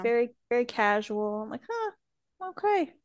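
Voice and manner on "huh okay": high, soft little reaction; retold mild surprise. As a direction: react small and high, as if privately puzzled. Retold reaction to casual outfits.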